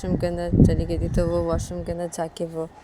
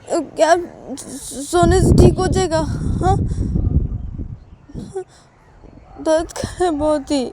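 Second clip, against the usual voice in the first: high, loud, crying disbelief; tearful protest; 9 semitones higher, 6 dB louder than usual. Acted. Wife in pain, tearfully doubting her husband. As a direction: plead through tears.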